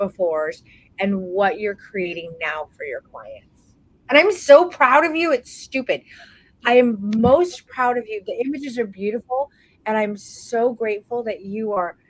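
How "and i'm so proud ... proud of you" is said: loud, high burst of pride; gushing pride. Real, not acted. Coach telling her student how proud she is, loud and gushing. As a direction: gush over her.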